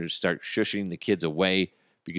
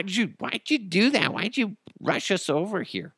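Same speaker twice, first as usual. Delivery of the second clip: put-on high, childlike whining voice; mimicking his kids; 13 semitones higher than usual. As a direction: play the puzzled kids. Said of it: "My kids are like".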